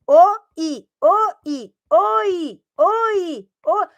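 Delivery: loud, quick chant of "o, i". Shown as a demonstration. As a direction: speed up the "o, i" until it merges. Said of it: "Mais rápido".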